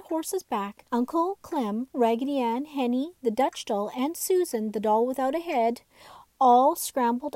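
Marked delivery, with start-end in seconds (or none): none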